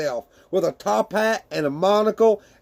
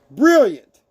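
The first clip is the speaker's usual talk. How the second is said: Brilliant called out louder and higher; exclaimed brilliant; 5 semitones higher, 8 dB louder than usual. Shown as a demonstration. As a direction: belt out Brilliant as a cheer. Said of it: "we say brilliant".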